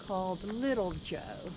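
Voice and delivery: normal talking voice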